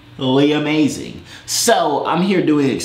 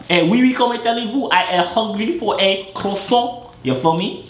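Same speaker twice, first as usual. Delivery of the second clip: hammed-up French accent; mock French accent; 9 semitones higher than usual.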